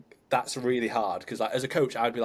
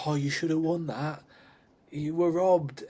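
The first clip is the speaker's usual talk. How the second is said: mock consoling; 5 semitones higher, slower than usual. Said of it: "people will say to their loved ones".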